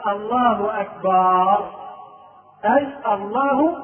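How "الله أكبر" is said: أكبر stretched into akbaar; slow takbir with أكبر ending in -baar; long final vowel. Shown as a demonstration. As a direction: hold a long aa after the b of أكبر. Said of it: "فحينما يقول القائل".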